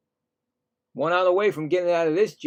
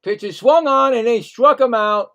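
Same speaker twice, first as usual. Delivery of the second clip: higher, louder call on the strikeout; excited strikeout call; 7 semitones higher, 8 dB louder than usual. Real, not acted. Commentator calling the strikeout that ends the at bat, excited and louder.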